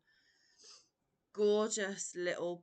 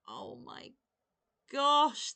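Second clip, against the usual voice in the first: awed exclamation; 7 semitones higher, 9 dB louder, slower than usual. Real, not acted. Overwhelmed by gift.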